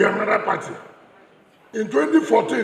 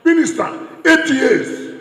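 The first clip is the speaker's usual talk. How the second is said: louder, punched cry; emphatic shout; 6 semitones higher, 7 dB louder, slower than usual. Real, not acted. Speaker to rally crowd about the minister years, a loud cry. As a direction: hammer the number home.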